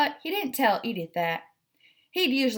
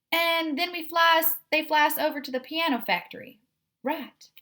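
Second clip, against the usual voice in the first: put-on lightly done Southern belle accent, higher and fast; a hint of a Southern belle, eager to check the plan; 7 semitones higher than usual. Edith checks the plan with Stick Cat, light Southern belle accent. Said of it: "we're gonna do the Southern Belle".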